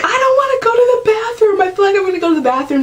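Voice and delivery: very high, fast, panicked; retold panic to the nurses; panicked whine. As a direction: squeal it in alarm mid-push. Panicked while pushing in labor.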